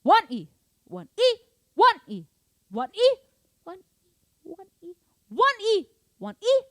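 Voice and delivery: varied high loud accents on "one E"; mixed accents. Shown as a demonstration. Drum teacher mixing accents, loud sung counts. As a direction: sing mixed accents loudly. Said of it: "mix it".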